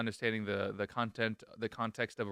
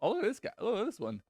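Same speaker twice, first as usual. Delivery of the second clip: put-on high girly bartender voice; 12 semitones higher than usual. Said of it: "She was just like".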